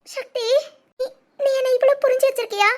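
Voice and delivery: faint tearful name, then sobbing question; overwhelmed, weeping as she asks; crying. Acted. Wife moved by her husband's understanding, crying.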